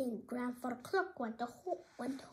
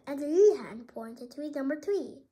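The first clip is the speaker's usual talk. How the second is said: usual speaking voice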